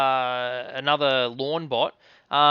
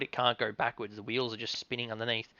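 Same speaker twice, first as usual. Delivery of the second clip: ordinary talk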